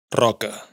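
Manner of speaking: "Roca" con erre inicial vibrante múltiple; erre fuerte. Shown as a demonstration. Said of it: "se pronuncia fuerte". (erre vibrante en "Roca")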